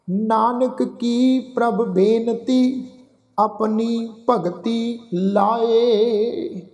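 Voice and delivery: slow, drawn-out chanted reading of the verse; measured Gurbani path recitation; slow scripture recitation. Shown as a demonstration. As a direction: recite each line slowly and reverently. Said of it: "ਆਓ ਉਚਾਰਨ ਕਰੀਏ ਜੀ".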